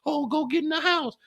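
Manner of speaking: high mocking voice of a bossy mother; mocking her mama. Gold cloak mimicking baby mama's mother, high and mocking. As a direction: squeak it up like a nagging mom. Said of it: "your fucking mama that".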